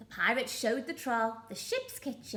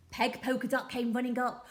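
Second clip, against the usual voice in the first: ordinary delivery, nothing marked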